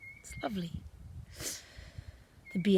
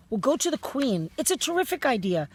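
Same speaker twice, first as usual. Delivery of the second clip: higher and louder, eager; eager cry; 6 semitones higher, 5 dB louder than usual. A narrator's reading. Sophie proposing to go to the Queen, excited. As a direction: pitch it up with enthusiasm. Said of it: "cried Sophie".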